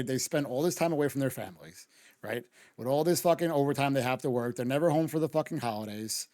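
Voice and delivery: ordinary delivery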